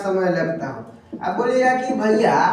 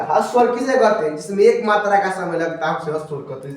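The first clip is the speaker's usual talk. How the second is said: quick recital; faster than usual. Shown as a demonstration. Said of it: "आपको हम समझा देंगे पूरा".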